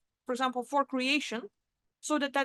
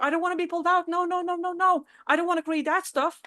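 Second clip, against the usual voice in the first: higher, insistent pleading; acted fretful protest; mock panicked refusal; 4 semitones higher than usual. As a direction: refuse in a hurry, lightly acted fear. Acts frightened person refusing.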